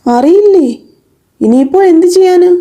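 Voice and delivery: high-pitched puzzled questions; taken aback. A narrator's reading. Uncle Moon learning the boy doesn't know the house, taken aback.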